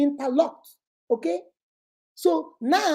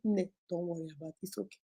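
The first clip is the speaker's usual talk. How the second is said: very soft, low reassurance; hushed comforting, quicker and lower; soothing; 4 semitones lower, 14 dB quieter, faster than usual. Acted. Support friend calming an upset wife, soothing.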